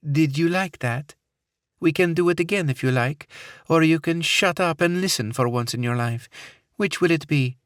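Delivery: put-on high, taunting Middle Eastern accent; a cold captor who enjoys the threat; menacing woman, Middle Eastern accent.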